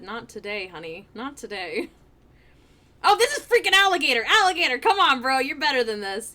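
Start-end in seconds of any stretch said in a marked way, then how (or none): 0.0-1.9 coy soft taunt. Retold or imitated. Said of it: "Leon said".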